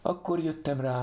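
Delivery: plain reading voice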